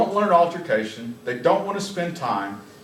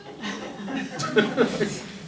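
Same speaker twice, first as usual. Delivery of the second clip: laughing; 8 semitones higher, 4 dB quieter than usual. Real, not acted. Laughs at officers in video.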